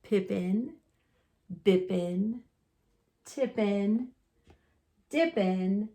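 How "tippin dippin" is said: ordinary delivery, nothing marked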